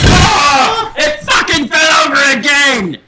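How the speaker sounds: high, loud exasperated outburst; genuinely fed up, loud; real frustrated shout. Real, not acted. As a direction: yell at the board falling again. The board falls over again.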